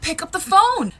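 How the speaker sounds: loud, high mock-mom yell; insistent mom shout. Mom ordering her sobbing child to the phone, insistent shout. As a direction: imitate a mother yelling an order.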